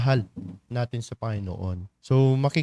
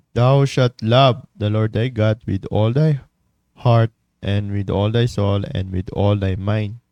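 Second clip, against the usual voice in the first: slow, weighty Bible reading; scripture reading; 3 semitones lower, 4 dB louder than usual. Acted. Preacher reading scripture to the congregation, slow and solemn. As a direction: read the verse slowly and solemnly.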